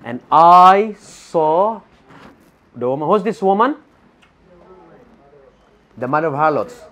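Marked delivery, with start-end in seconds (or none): 0.0-3.1 very slow, word by word; read slowly. Shown as a demonstration. Preacher slowing the verse for listeners. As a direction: stretch it out so they follow. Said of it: "let's read slowly".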